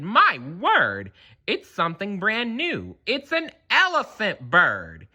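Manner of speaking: high, wonder-struck exclaiming; amazed crowd. A narrator's reading. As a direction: marvel at it aloud. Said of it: "they shouted".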